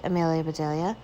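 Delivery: ordinary delivery, nothing marked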